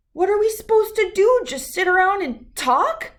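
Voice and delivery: high, loud cub complaint; indignant Brother. Acted. Brother objecting to Mama about just talking, high and loud. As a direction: groan it as an outraged kid. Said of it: "said Brother".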